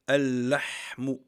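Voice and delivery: "Al-Lahmu" loud and slow with a long held L; doubled l. Shown as a demonstration. Teacher showing the meat word, L doubled loudly and slowly. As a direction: lengthen the L and the h in "Al-Lahmu". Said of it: "on va dire".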